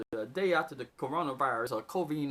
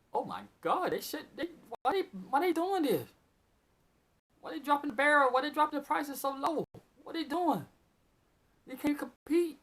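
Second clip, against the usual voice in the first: agitated disbelief; 10 semitones higher than usual. Real, not acted. Agitated about oil price drop.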